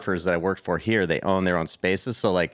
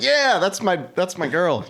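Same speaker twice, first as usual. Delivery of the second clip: excited; 10 semitones higher, 4 dB louder than usual. Retold or imitated. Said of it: "And I was like".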